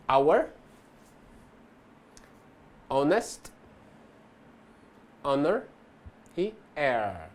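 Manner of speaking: our, honest, honor said one at a time with no H sound at all; silent H demo. Shown as a demonstration. Teacher to students, slow demo of the silent H. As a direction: pause after each word and drop the H completely. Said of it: "ele é silent".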